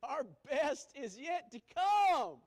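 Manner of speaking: a joyful laugh through the words, slow. Real, not acted. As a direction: laugh it out with delight. Preacher laughing, slow, loud.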